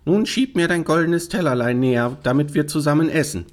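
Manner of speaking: high-pitched frog character voice; demanding frog. Acted. Frog at table asking for her plate, demanding. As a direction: play a frog inviting itself to dinner. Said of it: "sprach er".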